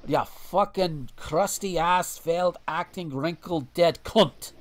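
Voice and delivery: slow, loud mock Scottish-Irish insult; Scottish or Irish accent. Hurling insults at Reagan in a hammed-up Scottish-Irish accent. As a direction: spit the insults slowly in a vague Scottish-Irish brogue. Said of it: "I don't know which accent I was going for".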